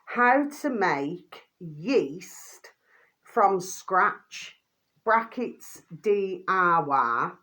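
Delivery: slow, spelled-out title reading; slow dictation. Shown as a demonstration. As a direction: read it out slowly for viewers. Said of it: "the video should read".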